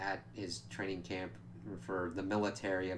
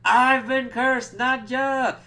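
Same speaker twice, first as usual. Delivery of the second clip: much higher, louder, slow drawn-out wail; panicked wail; 12 dB louder, much slower than usual. Retold or imitated. Nandor discovering the cursed email, hammed-up panicked wail. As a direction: howl it out in mock terror. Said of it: "He's freaking out".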